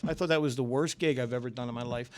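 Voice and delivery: ordinary delivery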